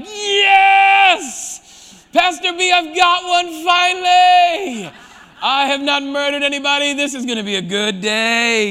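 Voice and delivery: excited cheer. Retold or imitated. Pastor voicing the congregation thrilled at an easy commandment, shouted mock joy. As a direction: cheer like you finally won one. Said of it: "everyone in the room is like".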